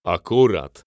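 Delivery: scornful snort. A narrator's reading. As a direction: spit it out in disbelief. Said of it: "prychnął narzeczony Klaudii".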